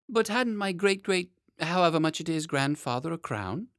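put-on high, puzzled child question; a boy querying the minister; curious little boy.

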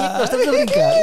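laughing. Real, not acted. High crying-laugh voice.

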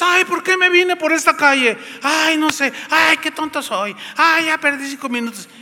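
high, exasperated muttering to yourself; flustered self-talk. Pretend. Driver scolding himself for the wrong street, exasperated. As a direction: sigh at your own bad luck.

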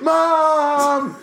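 high, loud mock holler; drawn-out pretend yell for mum; calling for Mom.

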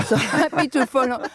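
quick, laughing, pitch up. Real, not acted. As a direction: laugh through the words. Jokes about loving Boris's jokes.

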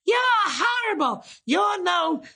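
put-on high shrill old lady shout; old lady yelling. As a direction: yell it like an outraged old woman. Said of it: "this old lady stands up and she's just like".

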